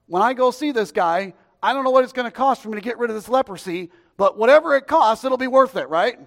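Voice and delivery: loud, high, determined self-talk; high, forceful, whatever-it-takes urgency; acted eager reasoning. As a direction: voice the man's thinking at full volume. Voicing the sick man's reasoning.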